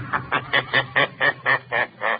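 eerie laugh of an unseen presence; mocking laugh. The Shadow laughing at Dubril's scorn before speaking.

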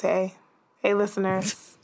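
husky seductive greeting; sultry raspy voice. Shown as a demonstration. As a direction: purr a sultry hello. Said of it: "this is my post-Valentine's Day voice".

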